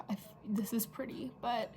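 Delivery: whispering. Real, not acted. Muttered aside.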